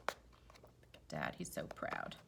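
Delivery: hushed whisper to the listeners; whispered aside. Real, not acted. Whispering to the kids about the proud dad. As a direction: breathe it like a secret.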